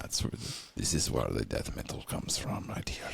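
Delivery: hushed whisper, low and soft; whispered aside. Real, not acted. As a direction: lean in and whisper it like a secret. Hushed aside about metal.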